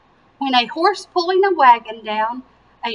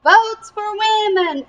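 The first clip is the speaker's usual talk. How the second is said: higher and slow, proudly proclaiming; proud slogan; 9 semitones higher, 3 dB louder than usual. A narrator's reading. Alice announcing her cause to reporters, proud and slow. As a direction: say it like a rallying cry. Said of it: "Alice told reporters".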